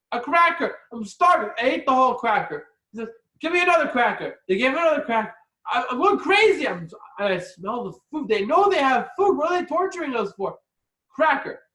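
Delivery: high, loud, frantic rant; starving guest losing patience, loud and high; exasperated rant. Retold or imitated. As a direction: build a hungry man's frustration. Starving gentile ranting.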